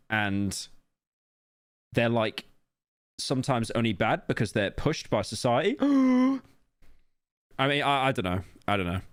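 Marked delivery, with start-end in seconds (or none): 5.8-6.4 sharp, high mock gasp of shock. Reacting to The Wire's message with a hammed-up gasp. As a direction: gasp as if stunned by the obvious.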